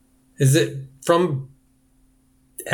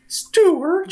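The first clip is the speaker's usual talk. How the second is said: very high squeaky voice with a crying edge; Stuart's mom. Retold or imitated. Mother urges Stuart to show the nice man, squeaking. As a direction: coax it in a shrill sing-song. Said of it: "I was thinking in my head".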